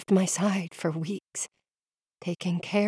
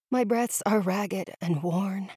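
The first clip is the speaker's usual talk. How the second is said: plain reading voice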